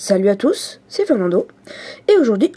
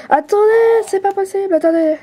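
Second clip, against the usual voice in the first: real disbelief; 9 semitones higher than usual. Real, not acted. A kid losing his game cries out in disbelief. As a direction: yelp it out high, stunned. Kid loses at Flappy Bird.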